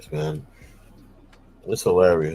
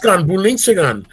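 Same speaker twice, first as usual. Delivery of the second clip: higher mock German gibberish; fake German accent; 8 semitones higher than usual. Fooling people with a higher mock German. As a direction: toss out fake German with a grin. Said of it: "fake German accent".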